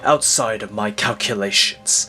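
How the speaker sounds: low, hushed, menacing narration; low brooding villain monologue; ominous villain voiceover. Acted. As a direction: speak like a shadowy schemer watching time.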